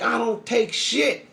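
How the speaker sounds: high, sharp indignant refusal. Retold or imitated. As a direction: snap back in disbelief.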